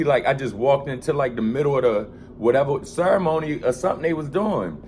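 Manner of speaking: ordinary talk, nothing marked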